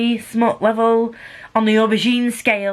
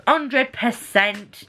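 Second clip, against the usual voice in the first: HUNDRED PERCENT drawn out very slowly, higher; slow, emphatic; 4 semitones higher, much slower than usual. Real, not acted. Reviewer giving a verdict on a book, slow emphatic "Hundred percent". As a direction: stretch out "Hundred percent", word by word.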